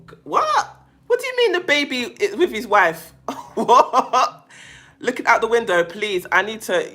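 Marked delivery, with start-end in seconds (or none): 0.1-4.5 sudden high squeak of disbelief, then a laugh; can't believe what she just read; shocked disbelief. Real, not acted. Shocked by a viewer's story.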